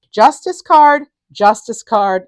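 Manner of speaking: loud, high burst on the card name; excited announcement. Real, not acted. Reader announcing the justice card to Libra viewers, loud and excited. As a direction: call it out like a big reveal.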